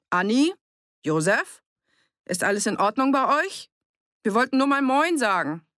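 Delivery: high, friendly hail through a door; calling out. A narrator's reading. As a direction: call each name and wait for an answer. Calling to people inside the house.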